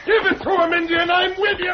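high excited yell; rally the ally mid-fight; cheering shout. Acted.

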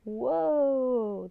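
loud, high, drawn-out whoa; long, swooping exclamation; startled cry. A narrator's reading. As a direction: cry out as the cart pulls up. Cart jolts to a halt.

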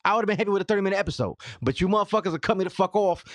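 high, exasperated venting; irritated. Real, not acted. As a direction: rant at them, fed up. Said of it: "Now I'm irritated".

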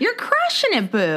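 squeaky high cheerleader voice; high cutesy cheer. Pretend. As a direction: gush it out high and cute. Teasing cheer, high put-on voice.

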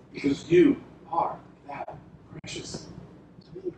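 near-whispered, very soft and intimate; tender hush. Retold or imitated. Jesus telling his beloved, a soft tender whisper. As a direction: breathe it like a secret of love.

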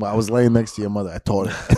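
dad voice. Shown as a demonstration. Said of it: "Nate Dillman does, like, a dad".

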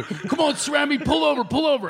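high yell after a car; calling out. Retold or imitated. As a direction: shout at the driver to stop. Yelling after the getaway car.

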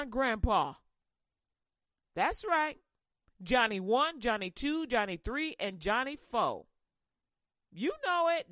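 full-voiced sermon cadence; preaching. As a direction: drive it home from the pulpit.

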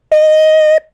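high loud beep sound; beep imitation. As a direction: squeal a machine beep.

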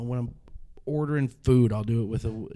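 normal talking voice

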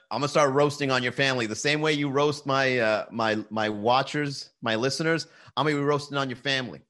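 usual speaking voice

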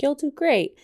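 bright encouraging parent voice; mock upbeat parent; cheery parent. Parent reassuring teen quote.

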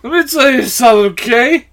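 put-on slurred drunk voicemail; lightly slurred drunk caller; drunk dialing. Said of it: "drunk voicemails".

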